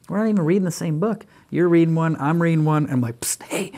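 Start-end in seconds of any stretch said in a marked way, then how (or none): 3.2-3.7 conspiratorial whisper. Pretend. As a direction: lean in and whisper to get her attention. Whispering to daughter while reading.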